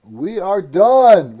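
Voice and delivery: loud, slow sign-off; loud announcing. Real, not acted. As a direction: call it out slowly. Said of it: "All right".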